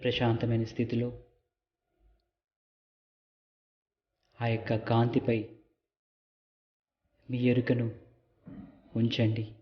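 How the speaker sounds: calm meditation guide with long pauses; guided meditation. Real, not acted. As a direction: lead them gently, phrase by phrase, leaving silences. Leads a light meditation. (నెమ్మదిగా, విరామాలతో ధ్యాన మార్గదర్శక స్వరం)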